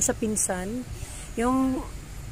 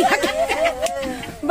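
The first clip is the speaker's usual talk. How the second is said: surprised laugh; 5 dB louder than usual. Real, not acted. Aunt crying on meeting visitors.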